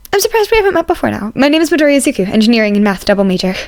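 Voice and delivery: a cheerful student, eager to reassure; eager young student. Acted. Izuku introduces himself.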